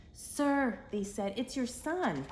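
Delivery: high, eager news; excited servants. Acted. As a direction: call out the news.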